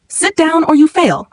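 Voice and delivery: sharp, slow threat; snapping order. A narrator's reading. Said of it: "Mister Garrett snapped".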